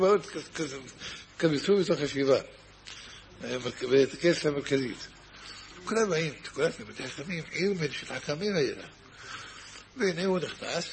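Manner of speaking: ordinary talk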